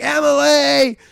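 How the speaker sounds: high, loud call after someone; mock yelling. Everyone chasing her to the barn, mock yelling. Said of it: "they're yelling her name".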